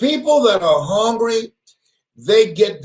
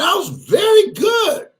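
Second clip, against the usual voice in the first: emotional outcry; 13 semitones higher than usual. Real, not acted. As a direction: insist on your worth. Emotional outcry about firing.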